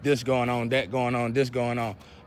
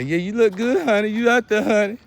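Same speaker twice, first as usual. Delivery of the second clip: mock flattering fan; 4 semitones higher, 3 dB louder than usual. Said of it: "And y'all can say".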